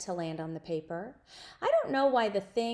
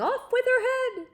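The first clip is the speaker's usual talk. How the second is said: high, loud mock royal yell; Queen's yell; 6 dB louder than usual. A narrator's reading. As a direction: bellow it like the Red Queen. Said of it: "always yelling".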